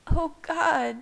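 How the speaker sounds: high, distraught cry of a character; near hysterical. A narrator's reading. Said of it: "her voice bordering on hysterical".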